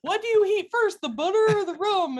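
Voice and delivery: high, loud imitation of a helpless question; exaggerated naive asking; mock clueless daughter. Voices clueless daughter asking her dad.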